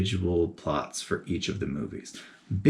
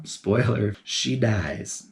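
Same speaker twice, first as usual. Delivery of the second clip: ordinary talk, nothing marked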